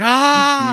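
high loud charging yell; battle cry. Retold or imitated. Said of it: "And he's like".

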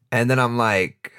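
ordinary talk

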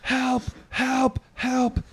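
high, half-hearted panicked help calls; retold screaming. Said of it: "I'm just screaming".

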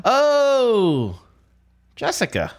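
high drawn-out knowing oh; surprised. Real, not acted. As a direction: realize who it is with delight. Host guesses the friend's name.

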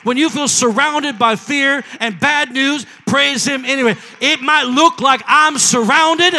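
loud, rising preacher's cry; preaching at full force. Acted. Pastor urging the church to praise, loud preaching. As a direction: build the sermon to a shout.

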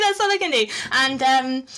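ordinary talk

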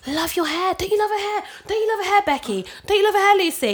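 breathless over-the-top compliments; gushing. Retold or imitated. Colleague gushing in meeting.

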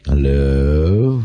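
low, very slow drawn-out hello; a husband answering unhurried while his wife panics; lazy drawn-out hello. Retold or imitated. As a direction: stretch the hello out lazily, deep down. Husband finally answers the phone.

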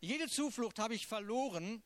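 impassioned reading of a lament; psalm reading. Acted. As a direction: pour the psalm out like a plea. Reads Psalm 142 aloud.

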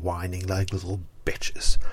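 a whispered insult; scornful whisper. Real, not acted. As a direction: drop to a sneering whisper. Contemptuous rant about whining supporters.

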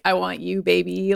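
low sultry purr; sexy. Retold or imitated. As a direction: seductive and soft. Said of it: "Said, said as sexily as possible".

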